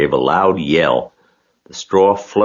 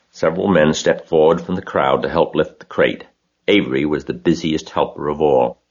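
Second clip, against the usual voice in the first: ordinary delivery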